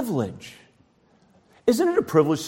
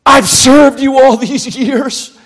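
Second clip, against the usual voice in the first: loud, slow, higher boastful shout; hammed-up proud son shouting at father; boastful elder brother; 8 semitones higher, 13 dB louder, slower than usual. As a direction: proclaim your own goodness loudly and slowly. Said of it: "How blind ... He says".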